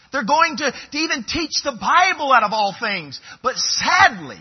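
high, loud, impassioned preaching; preacher warning churchgoers that works cover sin, at full force; preaching climax. Real, not acted. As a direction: build it to a fervent peak.

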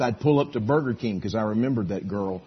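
ordinary delivery

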